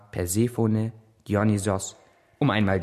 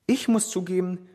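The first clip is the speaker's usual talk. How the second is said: usual speaking voice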